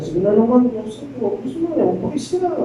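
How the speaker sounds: lightly voiced temptress pleading sweetly; seductive coaxing. Potiphar's wife coaxing Joseph.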